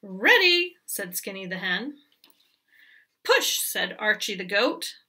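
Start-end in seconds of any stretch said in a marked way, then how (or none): 0.0-0.8 calling ready. Acted. Hen calls back. 3.2-3.6 high push. Acted. Goat urges the hen.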